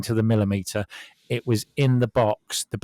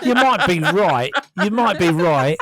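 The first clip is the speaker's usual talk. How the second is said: higher, louder, slowed by laughter; laughing concession; 7 semitones higher, 5 dB louder than usual. Real, not acted. Man teased over his excuse gives in, laughing. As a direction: concede through real laughter.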